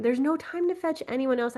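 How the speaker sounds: ordinary delivery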